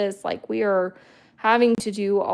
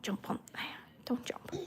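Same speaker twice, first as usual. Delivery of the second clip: whisper; 3 semitones higher, 15 dB quieter than usual. Real, not acted. Whispering to dog.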